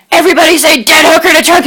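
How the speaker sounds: loud, fast, high call to pose; rally everyone for the photo; photographer calling out. Retold or imitated. Photographer loudly, quickly calls the group to say cheese.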